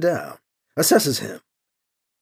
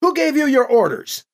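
high, insistent questioning; demanding; 8 semitones higher than usual. A narrator's reading. Priest to the commander about his orders, demanding. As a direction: press him sharply for an answer. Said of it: "B C demands of Urdru".

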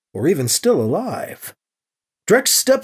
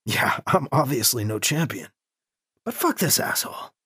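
soft, muttered resentment; quiet inner thought; 5 semitones lower, 6 dB quieter than usual. A narrator's reading. Priest privately dismissing the commander's remark, muttered. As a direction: mutter it bitterly to yourself.

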